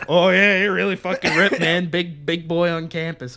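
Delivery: loud, taunting fake admiration; sarcastic mockery. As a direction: pretend to be impressed, laying it on thick. Mocking the wrestlers who claim effects.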